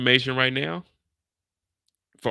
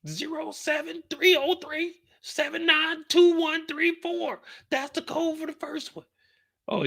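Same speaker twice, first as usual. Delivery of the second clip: overacted anime dub. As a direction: squeal out the numbers, broken. Squealing victim gives up codes.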